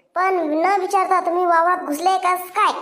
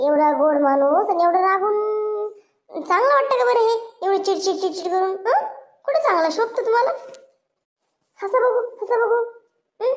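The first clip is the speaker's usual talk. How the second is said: coaxing flattery; 6 semitones higher than usual. Acted. Sweet-talking to calm him.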